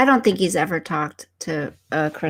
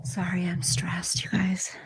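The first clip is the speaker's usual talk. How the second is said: breathy whisper, barely voiced; whispered apology; 8 dB quieter than usual. Real, not acted. Streamer whispers sorry to chat, stressed. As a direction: whisper it so only the mic hears.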